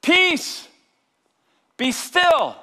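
high, loud command to the storm; Jesus shouting at the storm, commanding. Acted. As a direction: bellow it with authority. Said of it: "He shouts".

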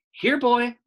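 high, loud call to a dog. Acted. Opal calling the dog across the store, high loud call. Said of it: "I said".

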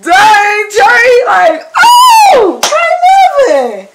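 very high, loud amazed shout; high hyped yell of praise. Retold or imitated. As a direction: shout in awe. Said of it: "I was like".

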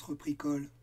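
ordinary delivery, nothing marked

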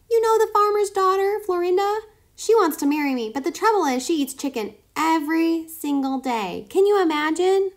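high, squeaky, eager voice; eager guinea pig. A narrator's reading. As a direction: sweet-talk the fox in a high squeak. Kai's squeaky sales pitch.

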